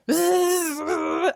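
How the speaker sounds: loud, high angry-swarm buzzing; freaked-out bee buzz. Retold or imitated. Bees freaking out as the trim comes off, loud frantic buzz. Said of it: "the bees start freaking out".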